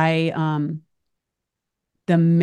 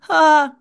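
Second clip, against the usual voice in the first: cry out; 11 semitones higher, 8 dB louder than usual. Real, not acted. Grief outburst mid-sentence.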